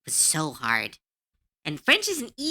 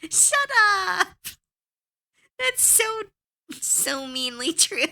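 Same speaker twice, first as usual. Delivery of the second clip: mock outrage; 14 semitones higher, slower than usual. Real, not acted. Teased by chat about English, high playful mock outrage. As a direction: protest the tease with a laugh.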